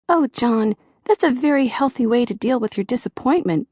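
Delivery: a lightly voiced little-girl therapist; little girl's voice. A narrator's reading. Therapist encourages John, a hint of little girl's voice. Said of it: "almost a little girl's voice".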